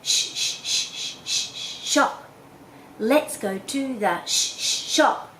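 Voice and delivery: "Sh, sh" hissed over and over, then "shop"; repeated sh game. Shown as a demonstration. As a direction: play a sound game, hissing sh before "shop". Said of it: "make a little fun game of it".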